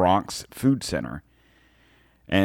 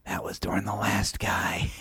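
mock-smug breathy whisper, pitched up; 5 dB quieter than usual. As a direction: sneer it quietly like a smug politician. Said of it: "he so smugly gets on there and goes".

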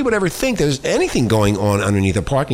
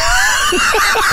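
high burst of laughter; shrieking laugh. Real, not acted. Laughing at the precinct answer.

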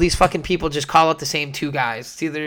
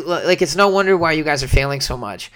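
ordinary talk, nothing marked

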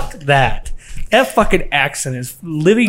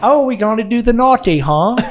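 higher, mocking British-girl accent; 9 semitones higher, 4 dB louder than usual.

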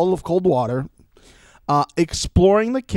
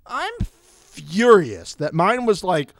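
ordinary talk, nothing marked

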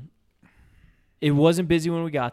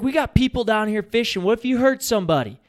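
loud, higher scolding call; shouting at kids; 9 semitones higher, 5 dB louder than usual. Retold or imitated. Yelling up the hill at kids.

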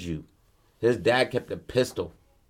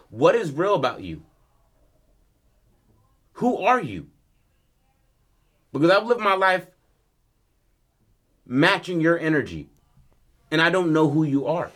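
high, loud, anguished demand; acted outburst, pitched well up and emphatic; heated confrontation; 7 semitones higher, 5 dB louder than usual. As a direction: confront your father, voice raised. Son confronts father in argument.

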